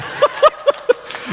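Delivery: high breathy burst of laughter; laughing. Real, not acted. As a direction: break up at the punchline. Laughs at mother-in-law's saying.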